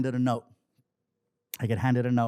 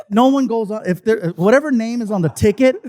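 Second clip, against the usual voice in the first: loud, high, insistent objection; acted-out indignant insistence; emphatic protest; 8 semitones higher, 9 dB louder than usual. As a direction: lay down the rule, raised voice. Acted protest to club manager.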